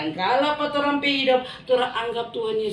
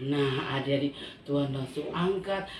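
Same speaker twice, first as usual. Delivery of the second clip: ordinary delivery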